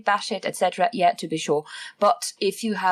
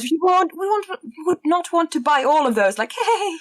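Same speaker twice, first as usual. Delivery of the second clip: laughing; 9 semitones higher, 3 dB louder than usual. Real, not acted. Laughing protest at buying models.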